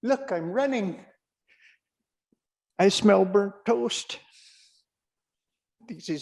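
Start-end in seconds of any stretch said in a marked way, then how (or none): 0.0-4.2 high, excited big-dog voice; squeaky-high, amazed dog talk; voice of the running dog. Acted. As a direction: play a dim dog thrilled to be running. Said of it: "And he's like".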